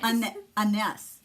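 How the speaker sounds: anise drawn out with stress on the end, uh-NESS. Shown as a demonstration. Offering a correction of the spice name, anise as uh-NESS. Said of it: "That's not how you say it".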